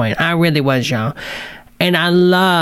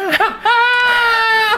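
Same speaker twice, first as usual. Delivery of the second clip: high shrieked laughing cry; shrieking laugh. Real, not acted. Cracking up at her own joke, high shriek.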